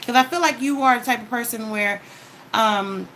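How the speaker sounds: ordinary talk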